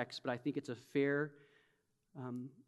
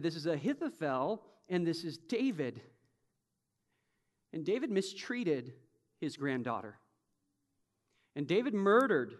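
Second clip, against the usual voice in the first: usual speaking voice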